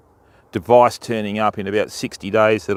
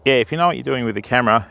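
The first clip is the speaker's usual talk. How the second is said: rattled off fast, catching up; quick restart; much faster than usual. Real, not acted. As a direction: hurry back through the thought after the interruption. Repeats line after raindrop interruption.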